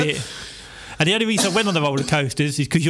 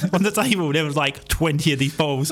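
normal talking voice

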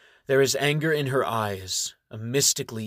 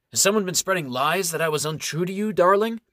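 fast, then a high loud rising darling; feigned innocence; 7 semitones higher, 4 dB louder than usual. Acted. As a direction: play the wounded sweetheart.

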